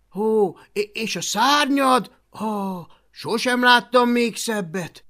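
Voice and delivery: gushing, high-pitched admiration; flattery. Acted. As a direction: marvel loudly with awed "ooh"s. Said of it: "hízelgéssel talán célt érhet".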